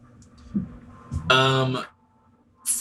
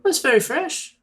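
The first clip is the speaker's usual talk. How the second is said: much higher, chirpy innkeeper; high barmaid voice. Acted. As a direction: greet guests as a bubbly barmaid. Innkeeper Mina answers about fish.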